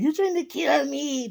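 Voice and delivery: a light put-on of the old guy; imitating old coworker. Repeating the coworker's line in a light high mock voice. Same coworker quote again.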